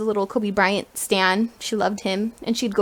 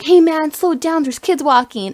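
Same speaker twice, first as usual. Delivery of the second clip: mildly annoyed scolding, raised pitch; mad; 5 semitones higher, 4 dB louder than usual. Retold or imitated. Uncle telling a speeding driver to slow down, mildly mad. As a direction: imitate a cross uncle telling someone off.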